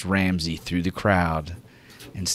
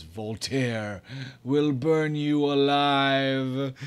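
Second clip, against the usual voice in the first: wraith's last words; 7 semitones higher, much slower than usual. Acted. GM voices dying Void Wraith.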